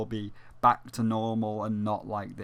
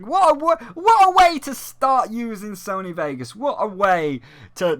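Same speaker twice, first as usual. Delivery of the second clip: high, loud outburst of mock amazement; pitched-up, incredulous cry; exasperated exclamation; 9 semitones higher, 8 dB louder than usual. Real, not acted. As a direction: exclaim loudly in exasperated disbelief. Sarcastic exclamation about the show.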